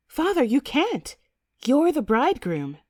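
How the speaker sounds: put-on high-pitched girlish protest; aghast daughter, voice jumping high; shocked gasp. A narrator's reading. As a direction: object in scandalised dismay. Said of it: "Gertrude gasps".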